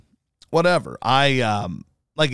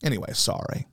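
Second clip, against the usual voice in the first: soft whispered sorry; whispered apology; 4 semitones lower, 7 dB quieter than usual. Real, not acted. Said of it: "now I'm getting angry".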